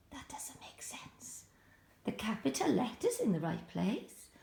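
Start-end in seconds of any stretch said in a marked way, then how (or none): 0.1-1.4 hushed puzzled whisper. Real, not acted. Teacher whispering to children that it's wrong. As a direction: whisper it like a secret to the class.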